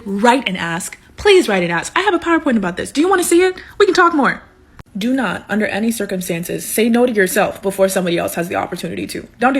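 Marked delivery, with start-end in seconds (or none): 2.0-4.4 higher, eager offer of a PowerPoint; bubbly and keen, like a nerdy fan; eager pitch up. Real, not acted. Telling students about her PowerPoint, higher and eager.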